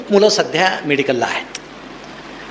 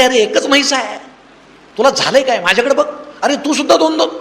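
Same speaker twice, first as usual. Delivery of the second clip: exasperated scolding; 6 semitones higher than usual. Acted. Friend scolds seeing-double friend.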